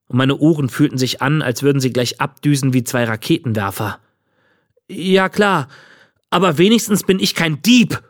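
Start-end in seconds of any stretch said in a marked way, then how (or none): 4.9-8.0 put-on high, indignant boy's cry; shouted retort. A narrator's reading. Boy to the old man calling him a bully, crying out. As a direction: hurl the accusation back in a raised pitch. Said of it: "schrie ich".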